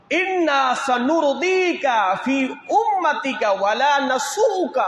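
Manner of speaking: very slow, high Arabic recitation; drawn-out proclamation of a divine message; solemn recitation. Retold or imitated. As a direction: stretch each word out, pitched high.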